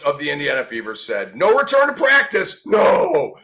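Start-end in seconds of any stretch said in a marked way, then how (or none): 1.4-2.6 higher, hammed-up announcement of the quote; mock dramatic reading. Host reading the coach's update, mock dramatic. 2.6-3.3 mock shock.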